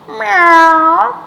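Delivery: high loud meow; cat meow. A narrator's reading. Storyteller meowing as Cat at Duck.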